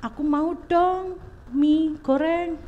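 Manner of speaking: lightly voiced child asking sweetly, slowed; a hint of a wheedling child, drawn out. Mother imitating her child begging for noodles, lightly childish. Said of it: "Wah, anakku gitu ya".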